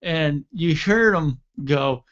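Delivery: ordinary delivery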